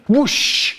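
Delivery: high mouth whoosh; whoosh sound. Acted. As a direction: zip away with a swoosh. Sound of dashing off.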